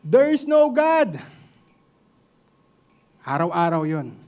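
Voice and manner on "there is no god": loud, high yell hurled back; high, slow, defiant bellow; defiant shout. Retold or imitated. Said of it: "sumisigaw rin pabalik yung atheist".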